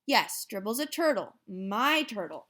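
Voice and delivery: usual speaking voice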